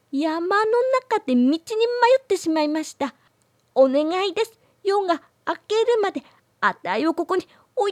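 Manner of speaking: put-on high pleading child voice; timid girl voice. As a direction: call out shyly for help. Girl explains her situation.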